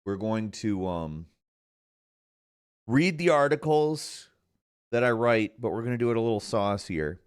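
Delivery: usual speaking voice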